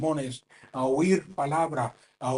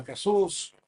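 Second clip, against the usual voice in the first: calling out; 5 semitones higher than usual. Retold or imitated. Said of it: "está gritando".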